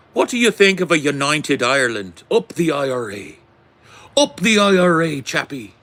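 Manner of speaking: put-on high-pitched, rousing Irish accent. Streamer voicing a chat question on united Ireland, mock Irish accent. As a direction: do a loud, high Irish rebel cheer.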